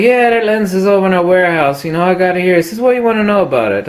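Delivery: a hint of an easygoing old clerk; old salesman impression. Old B and H clerk answering the caller, lightly voiced. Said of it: "I talked to some old guy".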